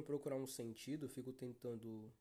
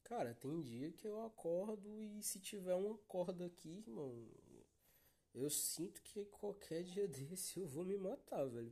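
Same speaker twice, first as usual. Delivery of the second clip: half-joking; 6 semitones higher than usual. Real, not acted. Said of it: "eu falo isso brincando".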